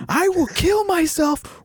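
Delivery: high, drawn-out screamed impression; mock screaming. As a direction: join in imitating the screaming woman. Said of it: "she's just screaming this".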